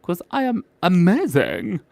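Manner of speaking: high-pitched, self-delighted cartoon presenter; showy ta-da character voice. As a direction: sing out a proud little reveal in a high voice. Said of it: "got her hand out like this going".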